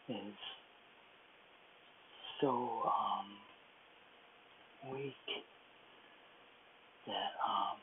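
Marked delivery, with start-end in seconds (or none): none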